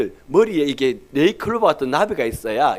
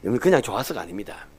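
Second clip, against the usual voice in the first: ordinary talk, nothing marked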